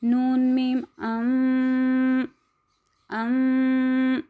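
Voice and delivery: नून मीम अम drawn out slowly; spelling recitation. Shown as a demonstration. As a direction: recite अम long and nasal. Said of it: "हमज़ा ज़बर". (धीमे हिज्जे)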